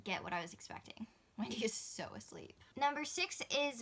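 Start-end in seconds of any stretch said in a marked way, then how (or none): 1.4-2.5 hushed whisper so the dog sleeps on. Real, not acted. Speaking softly about Wendy asleep beside her, whispering. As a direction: whisper without waking her.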